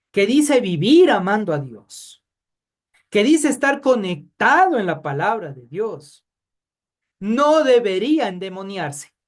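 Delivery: loud, insistent preaching; emphatic preaching. Acted. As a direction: press the point home to the church. Preaching at full force. (predicación enfática y fuerte)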